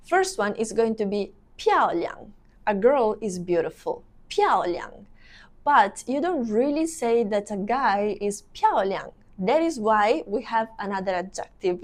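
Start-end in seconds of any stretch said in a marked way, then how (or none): none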